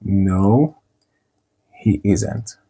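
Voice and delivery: ordinary talk, nothing marked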